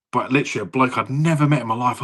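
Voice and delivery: ordinary talk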